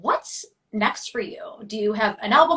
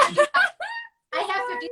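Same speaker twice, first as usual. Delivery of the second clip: puzzled; much slower than usual. Real, not acted. Host checking her notes.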